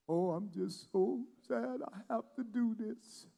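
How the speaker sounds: soft, mopey self-pity; hammed-up sorry-for-myself sigh; mock sad whine. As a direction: whine it like a pouting quitter. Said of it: "You imagine looking like".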